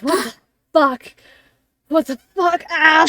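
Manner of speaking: high, loud, panicky yelling; frantic. Acted. Revived Leto looks around, frantic and shouting. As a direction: yelp in frantic confusion. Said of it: "and he's frantic".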